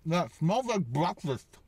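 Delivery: talking through a mouthful of food; chewing while speaking; mouth full. Real, not acted. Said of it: "Sorry, I talk with my mouth full".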